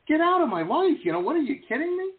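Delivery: high, loud, fast mock rant; mock outburst. Grouchy at fans' worried emails, hammed-up outburst. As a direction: blow up in a hammed-up huff, fast. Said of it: "And I'm like".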